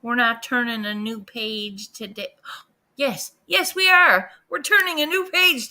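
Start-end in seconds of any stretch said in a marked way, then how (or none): 3.0-5.7 sudden high, loud yes; gasping, loud take-back; excited correction. Real, not acted. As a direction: burst in with the correction. Mock gasp, self-correction.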